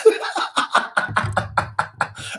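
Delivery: breathy staccato laughing fit; amused laugh. Real, not acted. Laughing about forgetting his own entrance, amused laugh. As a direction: laugh helplessly at your own story.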